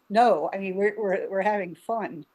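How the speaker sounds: usual speaking voice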